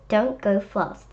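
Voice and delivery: ordinary delivery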